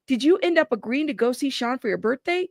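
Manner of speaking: usual speaking voice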